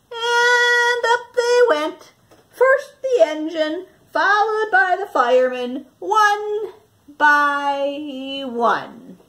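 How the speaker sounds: put-on lively storybook narration for young listeners; reading to children. Teacher reading Stuck to her class, lively storytelling voice. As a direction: tell it like a picture book to a class. Teacher reads picture book aloud.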